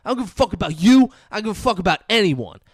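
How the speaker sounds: unhinged mock rage down the phone; furious shout. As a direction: yell it like you've lost control. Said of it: "He's like".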